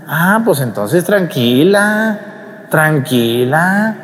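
slow, soothing reassurance; God calming a worried mother, slow and soothing. Retold or imitated. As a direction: draw it out to calm her down.

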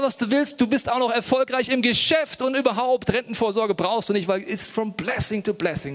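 höher, überschwänglich verstellte Stimme; spöttisch nachgeäfft. Retold or imitated. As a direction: die Wohlstandsprediger übertrieben naiv nachmachen. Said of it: "Das sind Leute, die erzählen mir". (Leute mit Wohlstandstheologie, spöttisch nachgeahmt)

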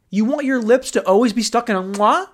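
usual speaking voice

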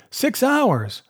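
high-pitched, rousing exclamation; rally yourself against the deadline; urgent cry. A narrator's reading. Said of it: "he cried".

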